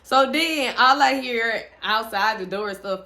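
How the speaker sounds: ordinary talk, nothing marked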